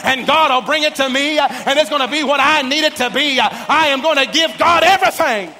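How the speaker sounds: loud, rising sermon cadence building to a shout; full-force preaching. Acted. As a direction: preach it up to the rafters.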